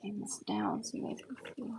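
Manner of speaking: quiet whispered aside; whispering. Real, not acted. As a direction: whisper it off to the side. Unvoiced aside.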